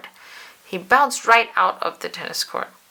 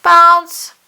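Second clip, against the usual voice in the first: loud high bounce cry; bounce sound; 10 semitones higher, 12 dB louder than usual. A narrator's reading. Narrator voicing the bounce, loud and high. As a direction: pop it out like a rubber ball.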